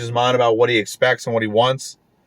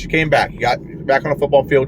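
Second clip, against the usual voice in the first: ordinary delivery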